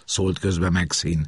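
normal talking voice

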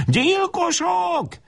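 very high, slow shriek. Acted. Pinned woman shrieking at the boys: very high slow scream. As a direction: scream it while thrashing. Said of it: "és visítja".

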